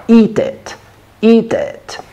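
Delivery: "Eat it" high, long ee then short i; "Eat it" with tense "ee" and lax "ih"; long-short vowel contrast. Shown as a demonstration. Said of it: "Кушать это eat".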